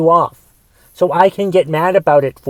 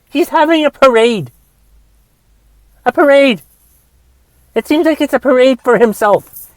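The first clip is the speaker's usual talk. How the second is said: high, loud, heated ranting; angry rant; 7 semitones higher, 3 dB louder than usual. Real, not acted. Podcaster ranting to listeners about Trump's parade, heated and loud. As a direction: vent it, voice climbing in outrage.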